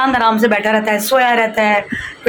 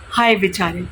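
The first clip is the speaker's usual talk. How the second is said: mock self pity; 3 semitones lower, 4 dB quieter than usual. Mock pity for herself, busy.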